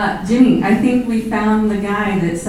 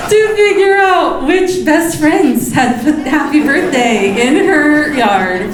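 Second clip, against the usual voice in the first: words breaking into laughter, higher; laughing; 8 semitones higher than usual. Real, not acted. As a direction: laugh through the words, delighted. Laughing at the Ring camera.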